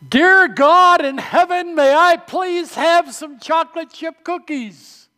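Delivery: high, slow, loud plea to heaven; child's loud shout. Retold or imitated. Boy in the cart shouts his last plea to heaven, loud and high. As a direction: belt it out like a desperate little boy. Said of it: "shouted in his loudest voice".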